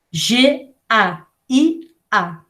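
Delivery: G A I A read slowly letter by letter; teacher dictating the letters G A I A; spelling out letters. Shown as a demonstration. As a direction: spell each letter G, A, I, A apart. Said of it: "É isso que ela escreveu".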